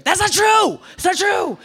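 high, loud outraged denial; shouting the denial at father; indignant shout. Retold or imitated. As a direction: yell it back in protest. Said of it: "my reaction to this was".

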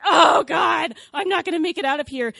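loud, fast panicked moan; mock panic. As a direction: blurt it out in a hammed-up panic. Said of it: "I'm like".

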